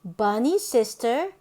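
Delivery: ordinary delivery, nothing marked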